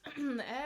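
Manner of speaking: quick throat clear. Real, not acted. Throat clearing before glissando.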